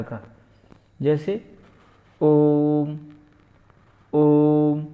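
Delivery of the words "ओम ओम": om held long and loud; drawn-out om. Shown as a demonstration. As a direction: stretch om over three beats. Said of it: "इसका ये चिन्ह है".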